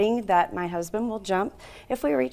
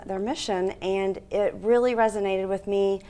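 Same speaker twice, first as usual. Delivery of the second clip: usual speaking voice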